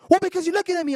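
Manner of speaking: high, loud mock complaint; imitated screaming woman. Woman accusing the man of staring, mock screamed. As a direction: imitate her yelling back. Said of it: "she was screaming loud".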